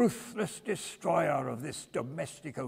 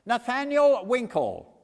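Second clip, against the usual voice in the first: loud courtroom call; 12 semitones higher, 9 dB louder, slower than usual. A narrator's reading. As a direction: call the name out slow and high. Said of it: "said Mister Skimpin".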